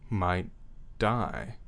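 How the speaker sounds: ordinary talk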